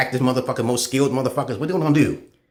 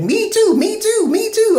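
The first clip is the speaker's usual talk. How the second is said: much higher, louder mock chant of women; 5 dB louder, slower than usual. Ridiculing women chanting the hashtag, mock high squeal. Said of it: "females don't understand that hashtag".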